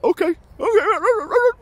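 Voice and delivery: very high, slow, squeaky okay; meek surrender. Retold or imitated. Vlogger backing off from the police officer, squeaky meek surrender. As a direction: back down like a scolded kid, high and slow. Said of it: "I was like".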